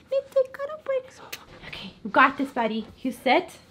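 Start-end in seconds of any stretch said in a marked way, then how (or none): 0.1-1.2 high squeaky voice for the dog. Pretend. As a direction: speak for the puppy, squeaky and high.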